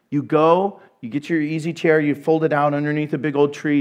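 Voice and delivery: usual speaking voice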